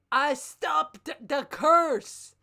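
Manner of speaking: slow, loud and high declaration; loud dramatic cry. Acted. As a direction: belt it out like a climax. Climax of read fic.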